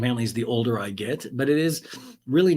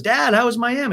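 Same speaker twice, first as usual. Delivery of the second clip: bright question; 11 semitones higher, 6 dB louder than usual. Retold or imitated. Aaron asks her father.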